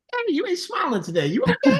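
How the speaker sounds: very high, rising friendly check-in; a stranger's cheerful, high-pitched concern; cheery greeting. Acted. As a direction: soar up high on the okay.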